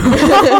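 high loud laugh; short burst of laughter; sharp laughing burst. Real, not acted. Laughing at the question.

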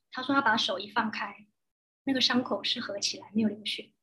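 ordinary talk

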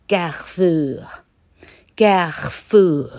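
CARREFOUR drawn out in French, throaty r; French pronunciation. Shown as a demonstration. Teacher shows students the French company name, French pronunciation. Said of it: "法文的话念作".

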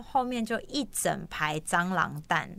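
normal talking voice

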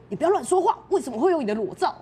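loud, high indignant yell; imitated outraged shout; retold shout. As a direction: bark back outraged. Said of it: "然后就大喊说".

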